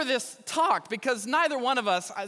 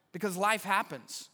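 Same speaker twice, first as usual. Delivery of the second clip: normal talking voice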